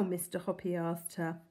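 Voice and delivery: ordinary delivery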